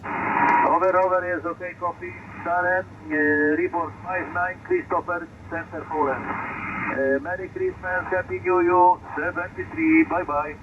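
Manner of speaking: distant voice over crackling radio; thin, far-off radio transmission; crackly military radio. Real, not acted. Ham radio contact over the air.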